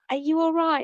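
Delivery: high, light British accent imitation. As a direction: mimic a kindly British lady in a high voice. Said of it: "like, British accent".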